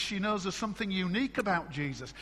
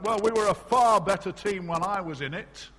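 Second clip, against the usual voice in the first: lightly mocking a pub bore, a touch louder; boastful old rugby bore; 2 semitones higher, 9 dB louder than usual. Preacher mimicking an old clubhouse bore, mildly mocking. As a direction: puff up like the old guy at the bar.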